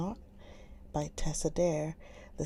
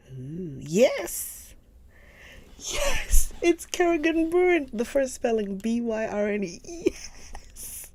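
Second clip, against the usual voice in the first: much higher and louder, bursting with joy; excited shout; 6 semitones higher, 8 dB louder, slower than usual. Real, not acted. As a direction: shout it like you finally found it. Finally finds the lost series.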